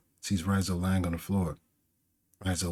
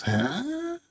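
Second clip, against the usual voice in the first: very high rising "huh?"; startled "huh". Acted. Orochi looks up at the breaking ceiling, high startled huh. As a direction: squeak out a puzzled gasp. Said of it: "he looks up".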